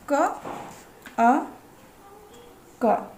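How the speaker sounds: क, then अ alone, then क, each short and separate; consonant plus vowel. Shown as a demonstration. Teacher showing how the vowel builds the letter, क and अ said apart. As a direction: split क into its sound and the short अ. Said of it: "इस तरीके से".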